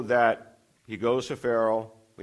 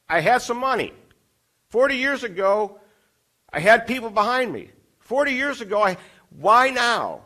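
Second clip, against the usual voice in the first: pleading Moses; 9 semitones higher, 5 dB louder than usual. Acted. Moses argues with God.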